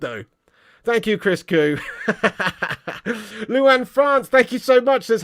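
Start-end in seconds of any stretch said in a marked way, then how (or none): 1.8-3.4 amused chuckling; laughing. Real, not acted. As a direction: chuckle away at the joke. Laughs after reading the super chat.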